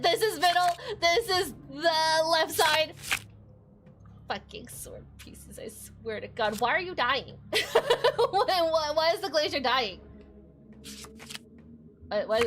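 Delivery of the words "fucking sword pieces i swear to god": muttered curse. Real, not acted.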